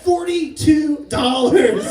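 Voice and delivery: FORTY-TWO DOLLARS said slowly, word by word; "Forty-two dollars" stretched out for comic weight; drawn-out announcement. Retold or imitated. Son announces his winnings to dad.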